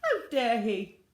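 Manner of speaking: loud outrage; indignant outburst. Acted. Missus Gregg fumes at the duck, loud indignant cry. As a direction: spit out your indignation.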